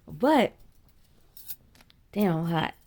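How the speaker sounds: ordinary talk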